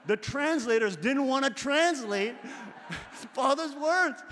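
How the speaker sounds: laughing through the words, high; can barely keep from laughing. Real, not acted. Laughing while telling.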